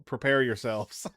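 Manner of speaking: ordinary talk, nothing marked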